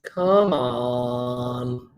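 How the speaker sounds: ordinary delivery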